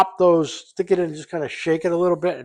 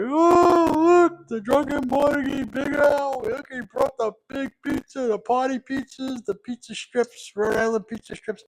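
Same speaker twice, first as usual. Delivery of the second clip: much higher, gushing mock party guests; excited family members; 11 semitones higher than usual. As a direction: imitate relatives cheering as he walks in. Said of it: "They're gonna be like".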